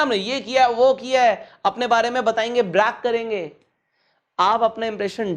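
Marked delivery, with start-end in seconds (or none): 0.0-1.5 mock boasting. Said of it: "चार लोगों को हम बोलेंगे ना".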